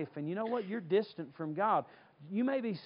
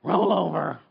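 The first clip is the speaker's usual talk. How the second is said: low, slow, grudging sleepy mother; weary mother to a scared kid; annoyed mom; 3 semitones lower, 7 dB louder, slower than usual. Retold or imitated. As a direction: sigh it out like a tired mom giving in. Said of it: "you just mark it".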